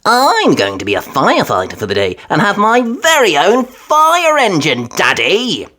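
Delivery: put-on high, eager little girl; excited Peppa. A narrator's reading. Peppa telling Daddy about her big day, eager. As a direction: stretch out fire engine with wonder.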